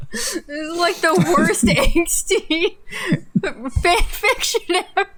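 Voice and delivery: giggling through the words; can barely get it out for laughing. Real, not acted. Laughing at the fan fiction.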